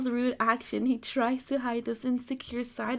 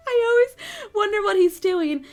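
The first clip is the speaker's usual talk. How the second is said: giggly; 9 semitones higher, 8 dB louder than usual. Real, not acted. Still laughing.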